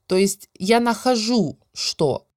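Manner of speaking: ordinary talk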